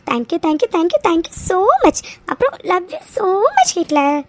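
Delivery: drawn-out, gushing thanks rising high; happy, lilting outpouring of love; overjoyed gratitude. Acted. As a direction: gush with happiness, stretching the words. Overjoyed thanks after family reunion.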